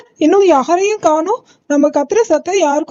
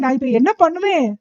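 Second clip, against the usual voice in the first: ordinary delivery, nothing marked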